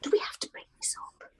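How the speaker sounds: fast whispered aside. Real, not acted. Guest whispering aside to the others, fast and hushed. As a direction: mutter it so the host won't hear. Private aside during session.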